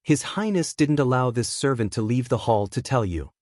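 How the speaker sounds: ordinary delivery, nothing marked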